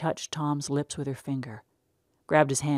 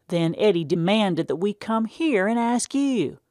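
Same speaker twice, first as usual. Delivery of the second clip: stern farmer father; 6 semitones higher, 5 dB louder than usual. Acted. Pa confronting Tom about unhoed weeds, loud stern country voice. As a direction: lay it on him like a stern old farmer. Pa continuing.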